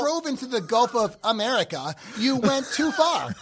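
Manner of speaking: mock local directions, building to the punchline; Midwestern direction-giver. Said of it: "you're giving someone directions".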